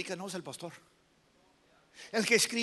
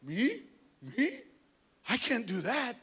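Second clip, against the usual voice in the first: squeaky rising disbelief; doubting Moses; slower than usual. Acted. Moses balks at God's call, incredulous. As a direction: question it with a steep upward swoop. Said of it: "El que dijo".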